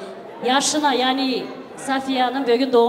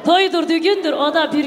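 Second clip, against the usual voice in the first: excited; 6 semitones higher, 6 dB louder than usual. Real, not acted. Host exclaiming at wedding.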